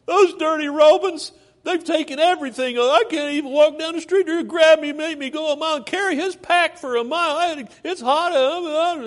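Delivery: high, loud mock grumble, slowed; grumbling complainer. Preacher mimics a believer griping about the Roman soldier, whiny. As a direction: whine about the Romans like a sulking kid.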